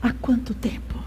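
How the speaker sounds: breathless whisper, almost no voice; whispered prayer. Retold or imitated. Preacher retelling his prayer to God, whispered. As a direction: let the question die into a whisper to God.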